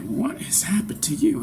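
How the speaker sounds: hushed, breathy disbelief; a whispering girlfriend, stunned by her man; whispered. Retold or imitated. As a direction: whisper it in shock. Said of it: "And she's like".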